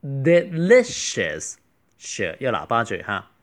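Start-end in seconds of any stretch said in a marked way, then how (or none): 0.0-1.6 Delicious slow, with li stressed and drawn out. Shown as a demonstration. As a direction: stretch Delicious and lean on li. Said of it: "大家要注意这个重音在li". (慢读Delicious，重音落在li)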